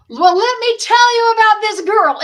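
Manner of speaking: Dee's big stage voice, high and loud; excited host voice, pitched high and loud; mock announcer boom. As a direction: proclaim it to the whole hall like a showman. Dee announcing her to audience.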